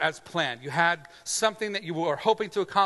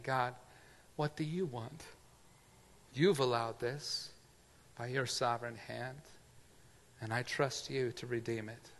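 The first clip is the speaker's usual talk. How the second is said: quiet prayer; 5 semitones lower, 9 dB quieter than usual. Shown as a demonstration. Prayer of trust voiced quietly.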